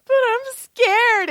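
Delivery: high, loud, slow whiny fear; hammed-up whimper to the man's blunt advice; mock scared whine. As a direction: play the frightened little kid. Answering advice to quit job.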